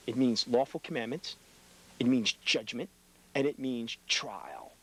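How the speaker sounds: slow, weighty final point; slowed, deliberate closing item; slow emphasis. Real, not acted. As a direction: draw out each word to land it. Preacher listing meanings of Deen.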